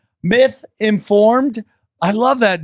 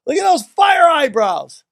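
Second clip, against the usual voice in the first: excited shout; 6 semitones higher than usual. Real, not acted. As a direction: hype it up, almost shouting. Excited over photo.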